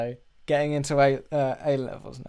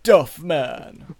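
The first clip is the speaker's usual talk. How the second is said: loud cartoon mascot announcement; hammed-up, louder character voice; Duff Man; 7 dB louder than usual. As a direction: boom out the name like a cartoon hype man. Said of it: "Can you do Duff Man?".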